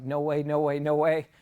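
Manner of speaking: can't believe what he hears; rising amazed no way; disbelief. Retold or imitated. Disbelief as truck appears.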